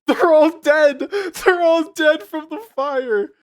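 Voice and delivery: high, loud hammed-up wail; mock anguished scream. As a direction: parody the actor's over-the-top screaming. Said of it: "He's just screaming at them".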